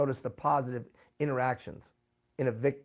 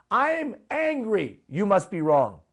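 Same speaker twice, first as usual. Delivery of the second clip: mock anger; 6 semitones higher, 6 dB louder, slower than usual. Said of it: "But basically it's this".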